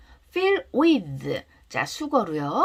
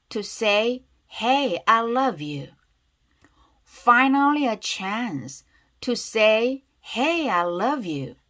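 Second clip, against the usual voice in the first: slow and deliberate, words drawn out; read-aloud pronunciation demo; much slower than usual. Shown as a demonstration. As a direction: model each phrase slowly for repeating. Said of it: "큰 소리로 따라해볼게요". (천천히 또박또박 늘여 읽는 발음 시범)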